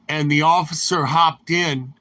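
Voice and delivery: normal talking voice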